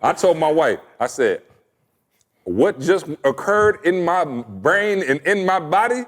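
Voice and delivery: ordinary delivery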